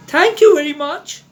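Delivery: high, grateful thanks; relieved polite child; little elephant's voice. A narrator's reading. Elephant's child thanks the python, high and relieved. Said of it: "said the elephant's child".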